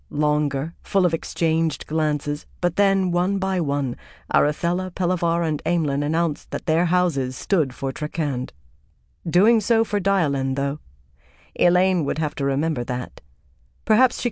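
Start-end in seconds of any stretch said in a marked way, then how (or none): none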